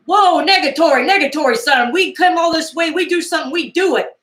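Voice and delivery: mock trucker bravado; Snowman's voice. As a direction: ham up the gruff trucker. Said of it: "And Snowman was like".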